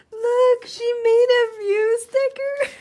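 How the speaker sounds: high, drawn-out squeals, nearly tearful; gushing delight. Real, not acted. Vlogger showing viewers her friend's handmade stickers, gushing. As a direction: gush and squeal, overcome with joy.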